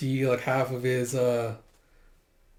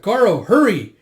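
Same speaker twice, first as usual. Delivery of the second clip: high, loud call; urgent shout; 8 semitones higher, 11 dB louder than usual. Acted. Villager sends Garo after the boy, urgent shout. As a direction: hurry him along with a yell.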